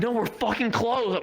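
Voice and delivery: high, drawn-out mock annoyance; irritated shopkeeper. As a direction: imitate an exasperated shopkeeper refusing. Acted angry shopkeeper refusing again.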